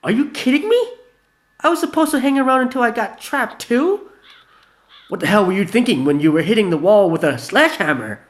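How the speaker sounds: high, loud angry yelling; furious outburst. Acted. Gina accusing Vanilla, loud angry yelling. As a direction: blast him with it. Heated quarrel overheard.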